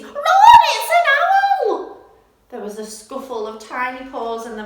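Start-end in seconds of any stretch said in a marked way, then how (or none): 0.0-2.0 very high, loud panicked shout; scream. Acted. Mother Mouse warning her babies, high panicked scream. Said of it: "screamed Mother Mouse".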